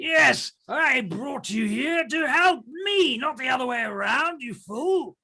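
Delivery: put-on high, slow scolding old man; angry old man. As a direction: scold them like fools.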